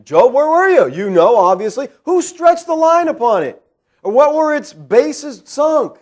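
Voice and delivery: loud, high, taunting questions; sarcastic cross-examination from on high; divine sarcasm. Retold or imitated. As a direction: grill him with mock incredulity. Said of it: "this is divine sarcasm".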